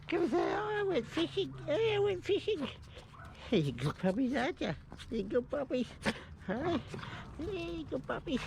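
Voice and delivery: high, sing-song voice for puppies. Real, not acted. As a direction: coo at the dogs in a high voice. Greeting the dogs at home.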